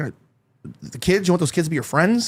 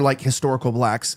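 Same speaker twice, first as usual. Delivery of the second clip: usual speaking voice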